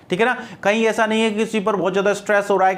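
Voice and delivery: ordinary talk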